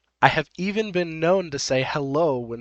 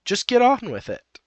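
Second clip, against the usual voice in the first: high, louder bossy command; impatient order; 10 semitones higher, 5 dB louder than usual. Acted. Narrator voices Happy walking off from OP, impatient. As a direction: order it impatiently, pitched well up.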